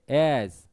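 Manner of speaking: एज with a long vowel; stressed, lengthened. Shown as a demonstration. Teacher repeating the stressed example, एज held long. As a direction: lean on एज and stretch it. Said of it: "लय लंबी हो रही है".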